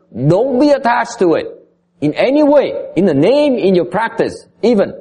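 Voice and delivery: impassioned teacher urging; emphatic command. Real, not acted. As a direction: lay down the rule with fervor. Teacher hammering the main rule.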